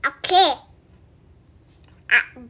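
usual speaking voice

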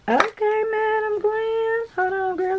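high, slow, drawn-out "Madam Glam"; high sing-song praise. Real, not acted. High drawn-out sing-song praise. Cheering the brand's gift.

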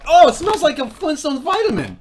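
loud, high-pitched groan of revulsion; disgusted. Real, not acted. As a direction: recoil from a nasty smell. Recoiling from a snack's smell.

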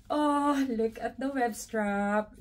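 ordinary delivery

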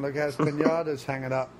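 ordinary talk, nothing marked

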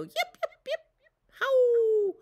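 very high yipping coyote howl; coyote yips. Retold or imitated. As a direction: yap like a coyote. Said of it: "Coyotes go".

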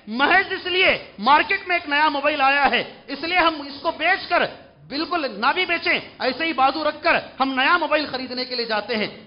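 high, loud sermon pitch; forceful preaching. Acted. Preacher complaining that youths heed nobody, forceful preaching. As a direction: hammer it out to the whole congregation. Preaches on buying new phones.

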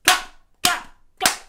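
loud sharp clap calls; stern clap. Acted. Grandma shooing the birds, loud sharp claps. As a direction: snap it out like a cross granny.

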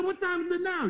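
high, panicky question; rising panic. Retold or imitated. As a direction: sound like the floor just dropped. Growing panic at hour.

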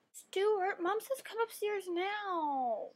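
put-on high, slow, drawn-out child whine; insistent little sister. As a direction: stretch the call out like a nagging kid. Little sister calls, more drawn out.

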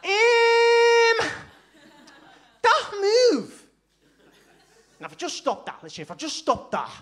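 pundit analysing tactics in thick Scouse; Jamie Carragher impression. Carragher as TV pundit breaking down Klopp's tactics, Scouse accent. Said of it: "making the Scouse accent sound intelligent".

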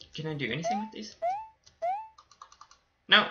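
normal talking voice

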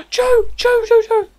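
repeated loud, high calling of a name. Real, not acted. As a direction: call out urgently for him. Calling the fox.

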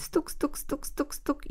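quick, hushed high taps; light, clicky bird-peck noises; pecking sounds. A narrator's reading. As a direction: imitate little beaks pecking at grain. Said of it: "dalej żedziobać".

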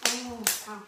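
usual speaking voice

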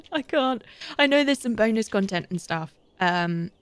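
ordinary talk

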